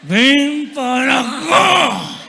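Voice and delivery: very slow, loud, stretched-out mother's yell; drawn-out yell. Retold or imitated. Mother yelling for her child across the house, slow and loud. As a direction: drag every syllable out as a long shout. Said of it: "te hablan así".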